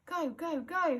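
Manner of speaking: put-on frightened boy shouting a warning. A narrator's reading. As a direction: yell at them to run. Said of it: "he shouts".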